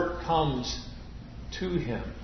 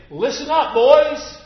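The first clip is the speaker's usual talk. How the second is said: high, loud call to the class; teacher's call; 10 semitones higher, 7 dB louder than usual. Retold or imitated. Teacher calling a class of boys to attention. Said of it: "He would say".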